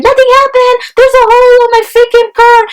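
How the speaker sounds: high, mock shrill outrage; indignant lady. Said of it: "And she's like".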